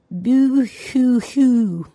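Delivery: put-on a child testing how crying sounds, drawn out; very slow pretend boo-hoo, trying it on; experimental boo-hoo. A narrator's reading. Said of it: "and then experimentingly".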